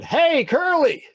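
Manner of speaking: high, loud drawn-out call; yelling. Acted. Benny interrupts the boy's thoughts, yelling. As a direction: holler it slowly across the yard. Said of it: "he keeps yelling".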